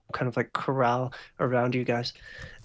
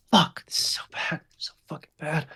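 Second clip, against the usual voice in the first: half-whispered, higher despairing muttering; distressed; 3 semitones higher than usual. Acted. Gunman pacing, head in hands, distressed. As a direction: sound like it's all falling apart.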